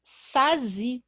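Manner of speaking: sazi with a z sound in place of the s; wrong reading. Shown as a demonstration. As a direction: say sazi with a buzzing z. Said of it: "vai ficar".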